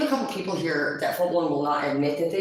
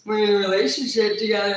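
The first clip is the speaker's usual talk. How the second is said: mocking Adrian; 4 semitones higher, 5 dB louder than usual. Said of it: "And he's like".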